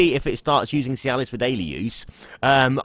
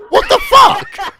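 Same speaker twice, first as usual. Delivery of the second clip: startled yell, drawn out; shocked shout; 9 dB louder, slower than usual. Real, not acted. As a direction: shout in disbelief. Guest suddenly left the call.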